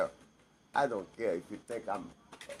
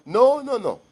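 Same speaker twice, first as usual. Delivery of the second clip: high, loud cutting no; furious, loud no; loud protest; 12 semitones higher, 11 dB louder than usual. Real, not acted. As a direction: shout them down. Rejects the excuse.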